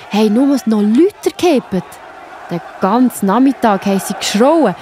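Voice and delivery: plain reading voice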